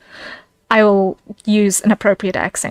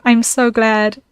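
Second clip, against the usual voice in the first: normal talking voice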